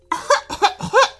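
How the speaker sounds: high, hammed-up fake cough. A narrator's reading. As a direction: cough it out, clearly faking. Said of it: "faked a cough".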